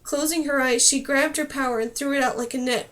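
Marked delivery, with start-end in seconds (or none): none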